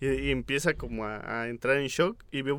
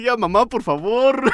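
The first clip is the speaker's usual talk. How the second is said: louder high pleading; retold plea; 6 semitones higher, 7 dB louder than usual. Teen begging his mother through the attic door, louder high plea. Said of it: "y le dice".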